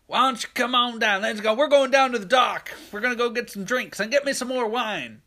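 high, loud aunt hollering; Aunt Rhonda. Retold or imitated. Aunt rounding folks up for the dock, high holler. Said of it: "we hear the voice of Aunt Rhonda".

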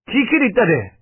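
high-pitched sharp question; high, agitated questioning; indignant demand. A narrator's reading. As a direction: demand an answer in outrage. Narrator demands who gave key.

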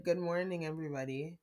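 low monotone teacher greeting. Retold or imitated. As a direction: drone it without any life. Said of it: "her monotone voice".

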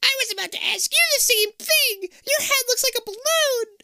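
very high, squeaky talking gerbil. Acted. Stacy with a shrunken head, speaking in a squeaky gerbil voice. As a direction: squeak it like a tiny rodent. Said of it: "Her voice is high and squeaky".

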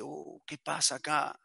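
soft, slow, drawn-out wondering; hushed puzzlement outside a door; wary aside. Acted. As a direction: wonder to yourself under your voice, slowly. Overhears a quarrel at the door.

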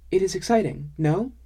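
eager, bright little question; excited Sumi. A narrator's reading.

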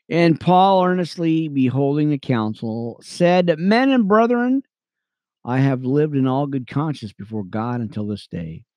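earnest Bible reading, lifting on names; steady scripture read. Acted. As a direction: read it like a preacher to his radio friends. Reads Acts chapter aloud.

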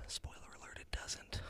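conspiratorial stage whisper; whispered aside. Real, not acted. Reviewer tells viewers the movie stays bad, whispering. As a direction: lean in and whisper the spoiler.

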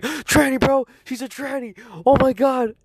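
high-pitched, frantic outburst; panicked retelling. Retold or imitated. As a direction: re-enact breaking wild news to a friend. Acting out his panicked phone call.

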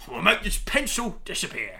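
put-on English magician's patter; Joker, English accent. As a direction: announce it in a mock-English accent. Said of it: "the Joker had an English accent".

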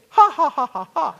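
booming, high "ha ha ha" laugh; rich man laugh. Retold or imitated. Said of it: "you laugh like a rich man".

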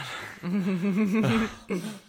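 short real laugh; embarrassed laugh. Real, not acted. Sneeze fizzles out.

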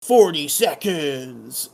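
slow, stretched-out count in a put-on voice; mock-suspense countdown. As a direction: draw the words right out for mock suspense.

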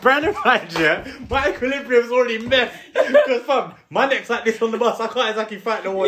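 can barely get it out for laughing; laughing through it. Real, not acted. Telling his mate the bus story while laughing.

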